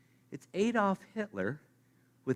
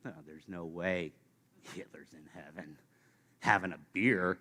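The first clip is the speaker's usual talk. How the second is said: scoffing mutter; 10 semitones lower, 6 dB quieter than usual. Pretend. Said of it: "third answer could be".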